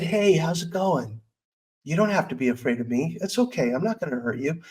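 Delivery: soft, gentle words to a frightened creature; hushed, tender comfort to her little anger ball; soothing reassurance. Retold or imitated. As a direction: coax it like a scared dog.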